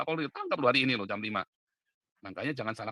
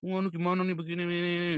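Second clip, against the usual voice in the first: lightly mocked higher-pitched panicky complaint; imitating a complainer; 6 semitones higher than usual. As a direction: mimic someone coming to you with a problem. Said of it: "YouTubers ngadu ke saya". (menirukan orang mengadu)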